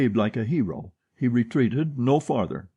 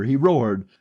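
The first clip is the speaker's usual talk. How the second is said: ordinary delivery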